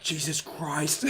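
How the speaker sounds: soft, slow exclamation; hushed awe. Retold or imitated. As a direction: breathe it out slowly in disbelief. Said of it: "I'm like".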